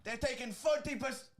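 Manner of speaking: put-on German accent. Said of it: "I can't do it".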